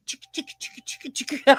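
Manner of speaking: whispery voiceless chicka-chicka scratch; imitated record scratching. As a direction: mimic a DJ scratching a record with your mouth. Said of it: "like, taking the record and".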